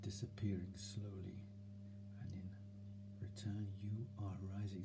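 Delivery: soft soothing voice. Shown as a demonstration. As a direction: soothe yourself like a child. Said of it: "See that tone of voice?".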